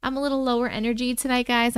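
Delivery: normal talking voice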